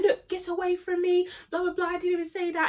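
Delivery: high-pitched imitated protest; defensive protest. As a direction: act out someone fending off an accuser. Acted quarrel between classmates.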